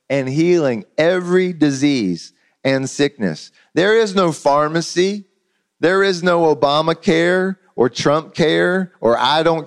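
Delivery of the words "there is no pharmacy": normal talking voice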